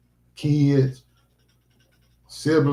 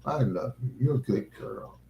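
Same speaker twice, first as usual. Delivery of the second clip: tender pet talk; 9 dB quieter than usual. Real, not acted. Tender words to dog.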